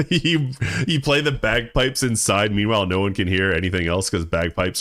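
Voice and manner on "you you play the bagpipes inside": high, giggly telling; laughing. Real, not acted. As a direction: start with a laugh, keep the pitch up. Cracking up at imagined bagpipe playing.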